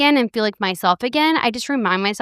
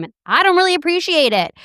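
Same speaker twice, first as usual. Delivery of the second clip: mock indignant; 9 semitones higher, 3 dB louder, faster than usual. Real, not acted. Mock complaint about slow soul.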